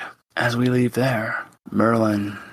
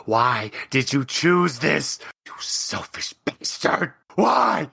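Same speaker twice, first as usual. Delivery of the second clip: anguished rage; 4 semitones higher than usual. Acted. Father screams at his son for letting her die. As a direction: hurl it at him, heartbroken.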